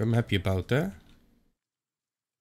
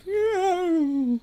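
high, eerie imitated ghost "Yeah.". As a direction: mimic the ghost's thin answer "Yeah.". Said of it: "voice from him, like".

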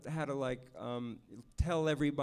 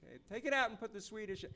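imitating director; 7 semitones higher, 5 dB quieter, faster than usual. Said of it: "and he'd go like".

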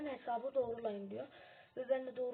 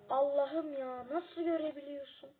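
amazed; 4 semitones higher, 7 dB louder than usual. Real, not acted. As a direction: stretch it out in astonishment. Amazed at the other's sight. (hayretle, yavaş ve yüksek sesle)

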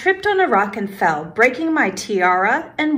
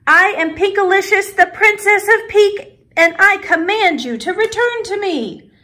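high, loud royal command; commanding princess; 10 semitones higher, 4 dB louder than usual. A narrator's reading. Princess ordering her wand back, commanding. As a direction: proclaim it like an imperious princess.

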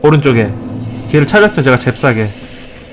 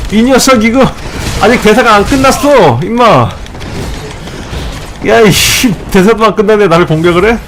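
higher-pitched, hammed-up outrage; mock indignant scolding; 5 semitones higher than usual. Hero scolds enemy for attacking mid-speech, mock indignation. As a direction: scold like an interrupted anime hero.

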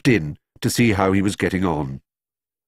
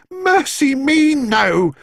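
put-on high, slow flustered old lady; flustered housekeeper; 4 dB louder, much slower than usual. A narrator's reading. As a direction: fan yourself, all in a fluster. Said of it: "said Missus Bird".

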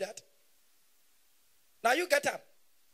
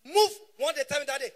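ordinary talk, nothing marked

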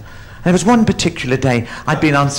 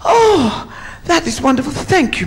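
high relieved sigh easing downward; relieved elephant; 10 semitones higher than usual. Acted. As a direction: gush thanks as a relieved elephant, starting high. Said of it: "and the elephant says".

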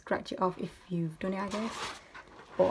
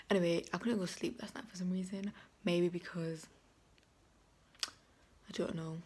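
whispering; 10 dB quieter than usual. Real, not acted. Early morning, others asleep.

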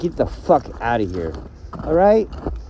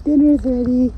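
high chirpy appliance voice; voiced microwave; 10 semitones higher than usual. The microwave telling him dinner's ready, high and chirpy. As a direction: announce dinner like a cheerful machine.

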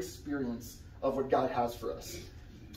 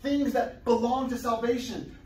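ordinary delivery

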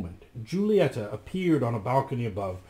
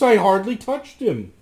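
protesting; 8 semitones higher, 8 dB louder than usual. Acted. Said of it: "protested Rasmus".

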